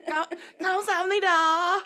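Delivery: high, loud laughing Southern-accented Korean. Shown as a demonstration. Co-host attempts Korean thanks for friends, laughing Southern accent. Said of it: "speak Korean with this accent".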